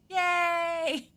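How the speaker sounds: high, loud, held-out yay; mock cheer. Streamer heading into park full of bodies, gleeful mock cheer. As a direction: cheer gleefully over the top.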